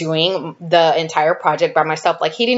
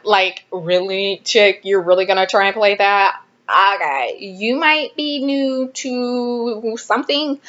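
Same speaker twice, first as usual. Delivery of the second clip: 1 sassy scoff; 3 semitones higher than usual. Retold or imitated. Scoffing at the classmate's trick.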